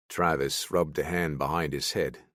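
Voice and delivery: ordinary delivery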